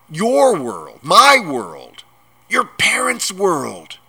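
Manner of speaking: loud, high, punched phrases; giant's insistent shouting; exasperated shouting. A narrator's reading. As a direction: hammer each phrase in exasperation. Exasperated giant loudly lists worlds.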